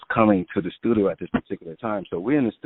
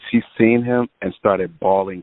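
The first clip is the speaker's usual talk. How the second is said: usual speaking voice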